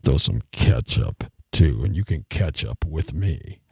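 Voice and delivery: loud, slow, croaking bass seducer; exaggerated deep ladykiller voice; frog with laryngitis. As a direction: croak out a pickup line very low and drawn out. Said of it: "a frog with laryngitis".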